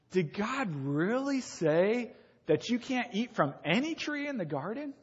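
a lightly voiced tempter sowing doubt; sly serpent. Serpent's question to Adam and Eve.

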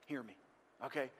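soft, measured reassurance with pauses; comforting. Acted. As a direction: gently get a grieving listener to listen. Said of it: "he sort of comforts her".

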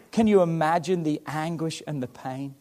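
normal talking voice

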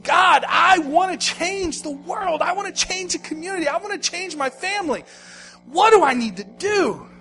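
high-pitched, impassioned cry to God; begging prayer, acted out; desperate plea. Man on his knees pleading to God.

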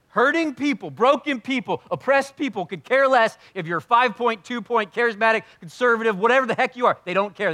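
loud, high, driving preacher's cry; preacher rising to a loud cry; preaching at full force. Real, not acted. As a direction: hammer it home to the congregation. Preacher building to a shout.

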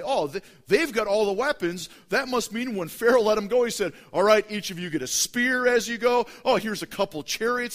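imitated scoffing listener; mock skeptic voice. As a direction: play the smug objector. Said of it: "you may say".

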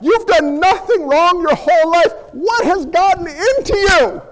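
a mother who cannot believe her son; pleading high scold; upset mother. Retold or imitated. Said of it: "She's saying".

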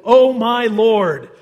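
loud, slow cry of alarm fading off. Acted. Servant panics at the army in the hills, loud alarmed cry. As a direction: call out in panic to the prophet.

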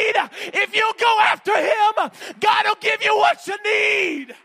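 high, loud preaching that builds to shouting; preaching at full force. Acted. Climax of the sermon.

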